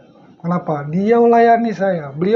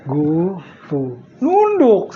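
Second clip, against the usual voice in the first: teacher's voice; 3 semitones lower, much slower than usual. Retold or imitated. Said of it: "kalau ngomong gini".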